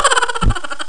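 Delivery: high scoffing laugh; mocking laugh. Real, not acted. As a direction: laugh it off derisively. Laughing at Angara program. (tawang nanunuya)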